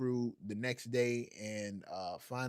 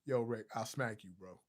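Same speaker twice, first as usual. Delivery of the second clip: ordinary delivery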